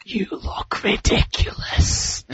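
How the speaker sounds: slow, drawn-out ghostly whisper; imagined telepathic voice. Acted. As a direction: whisper it like a thought sent into someone's head. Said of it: "first thing he'd hear would be".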